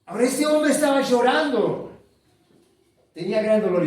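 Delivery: loud, high emotional sermon delivery; impassioned preaching. Acted. Preacher stirring the congregation, fervent and loud. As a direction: preach it with rising fervor.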